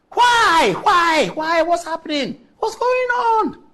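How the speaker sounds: pained shout of outrage, climbing high; exasperated outcry. Real, not acted. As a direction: cry out in disbelief at the scandal. Host outraged at scandal.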